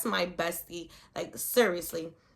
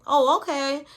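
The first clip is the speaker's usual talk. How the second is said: imitating a casual brush-off, pitched up; higher, louder mock-nonchalant response; 5 semitones higher, 6 dB louder than usual. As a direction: play an unbothered daughter's reply. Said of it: "she was real nonchalant, like".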